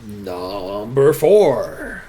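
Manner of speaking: slow, drawn-out video announcer. Pretend. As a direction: present it like a highlights countdown host. Mimicking a TF2 highlights video intro.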